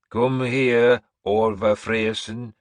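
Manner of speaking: loud, slow commanding crone; old seeress ordering. Acted. Seeress ordering Orvar forward, loud and slow. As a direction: summon him to the throne. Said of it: "Breda ordered".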